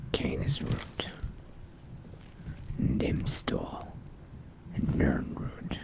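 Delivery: soft, halting thinking aloud; vampire musing to self. Acted. Vampire recalling Drews's alchemy recipes among ingredients, quiet muttering. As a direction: murmur low as you search your memory.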